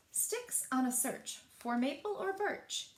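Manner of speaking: plain reading voice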